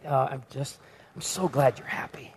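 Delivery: soft unvoiced mock comment; mock whisper. Pastor mocking churchgoers' approving Facebook comments, whispered. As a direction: whisper it like a fake-sweet reply.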